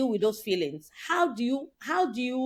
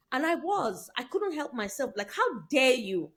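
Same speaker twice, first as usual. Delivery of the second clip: ordinary talk, nothing marked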